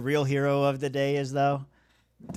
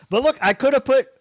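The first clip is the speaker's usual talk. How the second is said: exasperated outburst; 11 semitones higher, 11 dB louder than usual. Real, not acted. Regretting his lineup choice.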